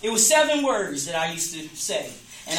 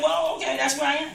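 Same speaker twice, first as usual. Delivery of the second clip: higher, resigned shrug of a voice; mock resigned churchgoer; 6 semitones higher, faster than usual. Mimicking churchgoers who accept being sinners, higher and resigned. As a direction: play a churchgoer giving up on changing. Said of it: "and they like".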